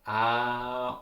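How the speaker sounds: ordinary talk, nothing marked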